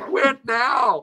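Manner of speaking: very high, loud heckling call; heckler's yell. Retold or imitated. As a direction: holler it like a heckler in the crowd. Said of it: "two guys for the rest of the show between songs yelling".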